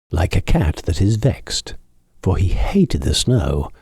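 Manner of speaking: usual speaking voice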